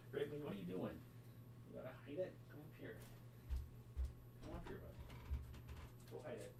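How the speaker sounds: turned away from the mic calling a pet; talking to the dog across the room. Real, not acted.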